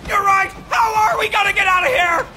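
loud, high-pitched frantic yelling; panicked shout. Acted. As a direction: yell in sheer panic to escape. Panicking to escape.

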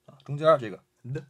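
ordinary talk

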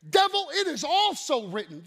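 loud, commanding rebuke; with authority. Shown as a demonstration. Right way to answer the devil, with authority. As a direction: rebuke the devil to his face. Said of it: "Like authority".

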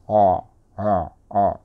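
loud "あ" R sounds, tongue tip never touching; hollow English R on "あ", tongue held free; English R, untouched tongue. Shown as a demonstration. Coach demonstrates English R, "あ" said loudly with the tongue not touching. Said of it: "触らずに".

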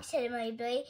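ordinary delivery

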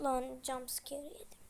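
breathless whisper after a scare; whispering to the viewers after a jumpscare; shaken whisper. Real, not acted.

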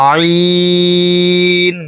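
আইন from a pressed throat, drawn long; throat-pressed Arabic letter. Shown as a demonstration. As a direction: squeeze the throat and stretch আইন. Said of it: "গলা চাপ দিয়ে". (গলা চেপে আইন টেনে)

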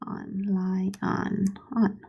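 slow whisper to herself; murmuring the word while spelling; whispered sounding out. Shown as a demonstration. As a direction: whisper the syllables as you write. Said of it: "whispers while writing".

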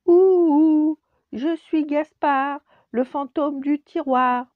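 ululement très aigu, très fort et lent; fantôme qui ulule plus fort. Acted. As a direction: pousse la voix pour faire peur. Second essai de Gaspard. (Gaspard recommence son chant, plus fort et lent)

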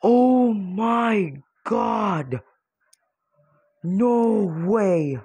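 drawn-out, high-pitched amazement; slow, high cry of disbelief; stunned disbelief. Real, not acted. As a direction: stretch each word out in real shock. Stunned at catching the character.

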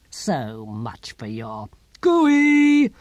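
slow build into a loud, drawn-out last word; loud, stretched-out taunt; defiant parting yell. Acted. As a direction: hurl it back defiantly, stretching the end. Philosopher's parting defiance at tyrant.

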